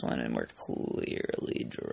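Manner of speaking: very low, slow, voiceless drone; low monotone. Pretend. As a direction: sink the voice down to nothing. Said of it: "How low monotone do you think my voice can go".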